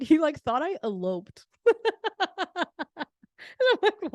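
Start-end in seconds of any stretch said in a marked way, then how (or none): 1.6-3.0 a burst of high real laughter. Real, not acted. Laughing at dad thinking she eloped, high real laugh. Laughing at dad's eloping guess.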